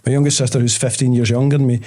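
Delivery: ordinary talk, nothing marked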